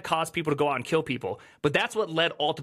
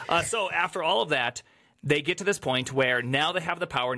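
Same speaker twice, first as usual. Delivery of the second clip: ordinary delivery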